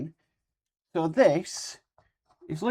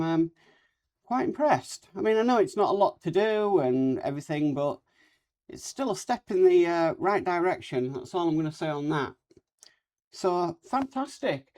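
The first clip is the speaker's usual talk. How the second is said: normal talking voice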